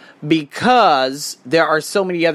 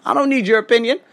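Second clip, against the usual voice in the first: ordinary talk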